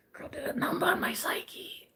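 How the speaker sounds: unvoiced confiding whisper to the girls. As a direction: lean in and whisper it like gossip. Confiding about ex-husband.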